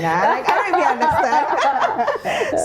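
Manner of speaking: amused, slowed, laughing. Real, not acted. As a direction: laugh it out in disbelief. Laughing at the husband's question.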